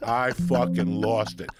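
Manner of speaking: loud, slow, worked up; excited recall. Real, not acted. Telling a friend about Pryor, loud and excited. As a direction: relive the moment you lost it.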